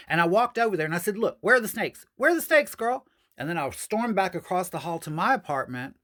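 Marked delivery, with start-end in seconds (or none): 1.2-3.1 retold confrontation. Confronting drunk neighbour.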